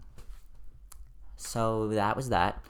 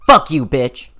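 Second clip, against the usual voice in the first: pretend yell; 3 semitones higher, 13 dB louder, slower than usual. Imagined boss yelling at her.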